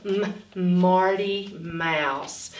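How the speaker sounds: "m, Marty Mouse" very slow, humming the "m"; m sound demo. Shown as a demonstration. Teacher modelling "m" in "Marty Mouse". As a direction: hum "m" before "Marty Mouse".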